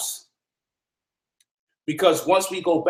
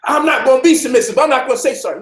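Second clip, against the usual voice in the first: higher and louder defiant mock protest; defiant refusal; 7 semitones higher, 6 dB louder, faster than usual. Imitating women who refuse to apologize, defiantly. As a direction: stand your ground, mocking the stubborn wife. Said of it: "some women probably say".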